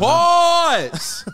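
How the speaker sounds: high, loud yell of pause; shouted pause. Real, not acted. Friend yelling pause at an innuendo, loud. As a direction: cut him off with a sharp shout. Friend interrupts suggestive word.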